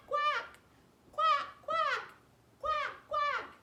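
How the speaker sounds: very high, loud quack quack; duck quacks. Shown as a demonstration. Teacher leading duck pose, high loud quacks. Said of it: "make quack".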